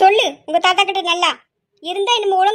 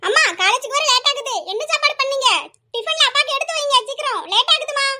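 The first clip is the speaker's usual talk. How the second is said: high-pitched, hurried call to mother; 6 semitones higher than usual. Acted. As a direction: call urgently from another room, pitch raised. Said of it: "அங்கிருந்தே அலறிக்கிட்டே வர". (அவசரமாக அம்மாவைக் கூப்பிடுவது)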